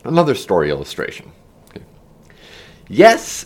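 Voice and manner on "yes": high, loud shout; shout over wind. A narrator's reading. Ben to Heron mid-flight, shouting over the air. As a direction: call out over rushing air. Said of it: "Ben shouted over the rushing air".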